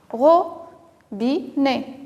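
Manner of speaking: "Robinet" very slowly split, ro, bi, né; syllable by syllable. Shown as a demonstration. Teacher confirms O is at the start, syllable by syllable. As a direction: give each syllable of "Robinet" its own beat. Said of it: "on entend le son ici".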